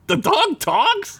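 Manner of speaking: very high, slow incredulous question; hammed-up high-pitched astonishment; astonished Boimler. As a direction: squeak it in mock disbelief, drawing it out.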